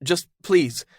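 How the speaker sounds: put-on high, loud urgent young man; alarmed Caden. Caden panicking, pressing Zelda on the phone. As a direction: demand the answer.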